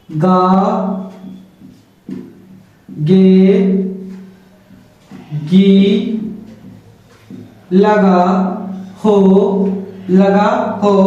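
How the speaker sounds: word by word with long pauses for notes; slow dictation. Shown as a demonstration. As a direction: dictate slowly so students can write. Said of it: "ये आप लोग लिखिएगा note में".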